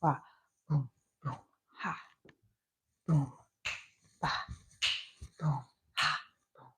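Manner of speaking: soft breathy beat noises; dance beat sounds. Shown as a demonstration. Comedian scatting dance beats softly while moving. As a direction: count out dance moves with whispered booms. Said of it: "choreograph, dance".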